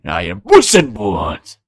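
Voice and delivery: slow, lightly voiced Spanish accent as Puss in Boots; a hint of a suave Spanish swashbuckler cat. Friend wishing for a Spanish accent tries slow Spanish-accented Puss. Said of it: "my impression of Puss, Puss in Boots".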